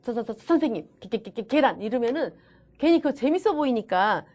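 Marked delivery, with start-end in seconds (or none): 0.0-1.8 demonstrated stammer on "서" and "게"; stuttered repetition. Shown as a demonstration. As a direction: repeat each first syllable before "선생님" and "계란". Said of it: "말더듬인 특히 또 친구들".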